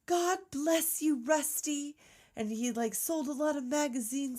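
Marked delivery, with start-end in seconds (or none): 0.1-1.9 high, slow sweet blessing; saintly nun role. Acted. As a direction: bless him like a movie nun. Acting actress as sweet flying nun.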